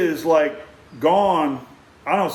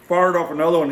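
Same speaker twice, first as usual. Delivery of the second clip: usual speaking voice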